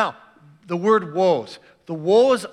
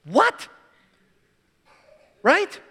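surprised; 10 semitones higher, 4 dB louder than usual. Real, not acted. Reacting to harsh reading.

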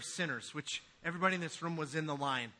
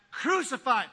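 loud mock mob shout; crowd yelling; 6 semitones higher, 8 dB louder than usual. Preacher voicing the mob's cry, shouted. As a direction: bark it out like an angry mob. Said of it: "that was yelling fifty days back".

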